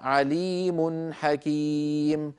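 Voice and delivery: very slow "عليم حكيم", long vowels; tajweed recitation. Shown as a demonstration. Teacher reads example before us, slow tajweed recitation. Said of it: "نرى الأمثلة أمامنا".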